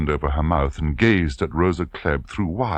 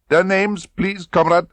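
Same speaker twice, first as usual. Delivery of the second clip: high, loud, clipped-off order; cold demand; 14 semitones higher, 6 dB louder than usual. Acted. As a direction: insist with menace. Klebb demands names.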